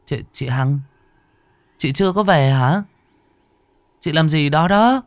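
high, hesitant call with a stumble; uneasy questioning. Acted. Ánh calls to a shadow she takes for a coworker, uneasy. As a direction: call out nervously, voice rising high.

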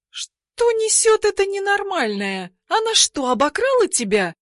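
very high outraged demand. A narrator's reading. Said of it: "возмущается девушка".

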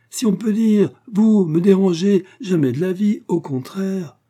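high, loud, emphatic protest; exclaiming protest. A narrator's reading. Shopkeeper assuring her guest he's no bother, exclaiming. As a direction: wave the worry away with hearty indignation. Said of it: "fit la brave femme, en levant les bras au ciel".

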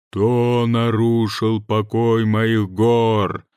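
slow, loud booming mountain spirit; thunderous voice. Acted. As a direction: boom it out like a mountain god. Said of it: "раздался громовой голос".

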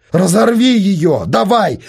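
a son insisting at full voice; insistent shouting. A narrator's reading. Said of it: "настаивал сын". (настойчивый громкий крик)

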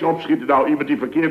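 ordinary talk, nothing marked

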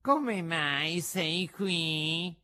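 high, very drawn-out fox question; effusive fox. A narrator's reading. Fox asks puppet why he's here, effusive. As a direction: draw it out slowly as the fox.

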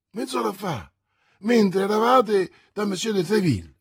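a hint of a breathless servant; eager servant. A narrator's reading. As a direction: keep the excitement going. Planchet reporting.

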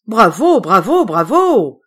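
a nephew loudly cheering the speech. A narrator's reading. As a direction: cheer to win back the uncle's favour. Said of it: "Clama Patrice".

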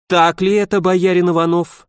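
frowning. A narrator's reading. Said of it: "нахмурилась княгиня".

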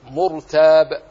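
"مرتاب" slow and loud with heavy ر. Shown as a demonstration. Teacher showing ra after damma, "مرتاب" with heavy ر. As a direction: draw out "مرتاب", thickening the ر. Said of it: "فخمت".

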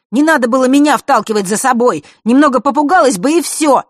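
high, loud furious shout; angry shout. Acted. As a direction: yell it in anger. Fesh keeps shouting angrily.

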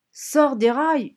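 loud, high, drawn-out yell; screamed command. A narrator's reading. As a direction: scream the order desperately, stretching it. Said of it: "hurla Franny". (hurlement aigu et traînant)

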